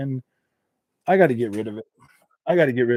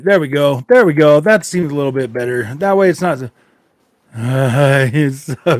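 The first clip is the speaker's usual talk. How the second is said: ordinary talk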